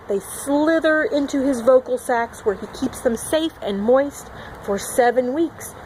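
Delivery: ordinary delivery, nothing marked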